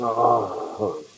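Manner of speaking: ordinary talk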